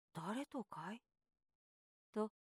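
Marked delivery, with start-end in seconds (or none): 0.1-1.0 a drowsy question from bed. A narrator's reading. Brother asks quietly.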